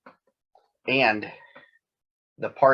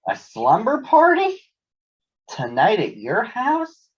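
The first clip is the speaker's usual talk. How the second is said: excited Sister; 10 semitones higher, 3 dB louder than usual. Acted. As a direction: gasp with delight at the idea.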